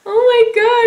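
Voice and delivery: hammed-up high, loud tearful wail; mock happy crying. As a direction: wail it like an overjoyed, sobbing mom-to-be. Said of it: "I just started crying".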